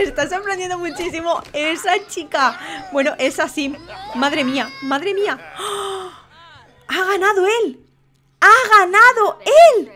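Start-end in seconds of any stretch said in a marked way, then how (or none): 4.1-7.8 stunned, held-back exclamation; stunned reaction. Real, not acted. Host sees who won the fight, stunned reaction. 8.4-9.9 loud, drawn-out, higher exclamation; emphatic disbelief. Real, not acted. Host repeats who won the fight, loud emphatic disbelief.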